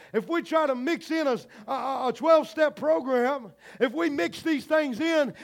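high, loud preacher delivery building to a shout; loud, high-pitched sermon fervor; full-force preaching. Real, not acted. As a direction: preach it hard, driving up to a shouted climax.

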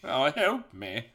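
distinguished old British gentleman, put on; drawn-out British plea for help; Tails. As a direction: play a slow, genteel British gent. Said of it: "He sounds like a distinguished old British gentleman".

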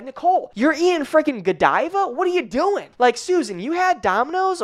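high-pitched mock outrage at a client; mock scolding. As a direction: pretend to nag her, pitch jumped way up. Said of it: "I'm not going to message you and be like".

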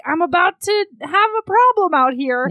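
desperate man outside toilet. Acted. Man knocking on the toilet door again, desperate. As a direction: mock-desperate man raising his voice. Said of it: "and he's like".

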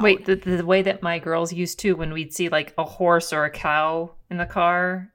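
ordinary talk, nothing marked

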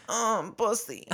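high-pitched whiny "um, bussy". Retold or imitated. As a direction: whine it in a high voice. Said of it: "Cam doing a high-pitched, whiny voice".

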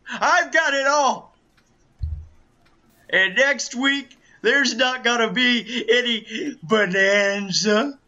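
loud, brash wrestler promo voice; boastful wrestling villain, shouting; Ric Flair impression. Plays the Nature Boy, Ric Flair.

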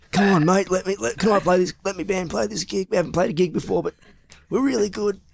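high wheedling hustler voice; sneaky voice. Retold or imitated. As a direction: coax it like a dodgy dealer. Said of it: "that's my sneaky voice".

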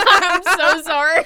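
high, loud apology through giggles; real helpless laughter over the words; laughing hard. Real, not acted. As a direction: crack up while apologizing, drawn out. Cracking up at the Mike joke.

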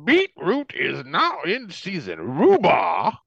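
high, slow, genteel Southern pronouncement; hammed-up Louisiana shopper, affronted; mock Southern accent.

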